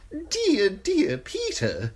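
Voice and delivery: high, sly eunuch voice, unhurried; high giggly spymaster relishing the gruesome news; Varys the eunuch. Acted. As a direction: purr it high and insinuating, a courtier savouring gossip. Varys voice held.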